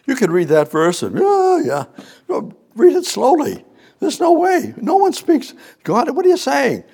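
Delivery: very high, loud fervent preaching; preaching at full force. Real, not acted.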